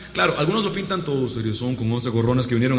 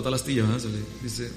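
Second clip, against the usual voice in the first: usual speaking voice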